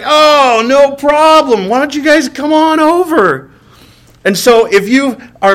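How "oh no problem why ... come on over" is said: higher, slowed fake-casual welcome; forced cheerfulness. Inviting drop-in friends over, higher put-on easy welcome. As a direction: sound easygoing while secretly panicking. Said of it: "And you're like".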